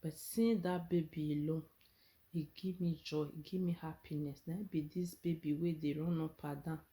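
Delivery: normal talking voice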